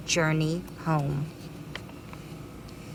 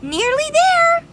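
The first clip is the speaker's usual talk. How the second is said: very high, louder call; fireflies calling; 6 dB louder than usual. Acted. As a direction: cheer him on. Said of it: "cried the fireflies".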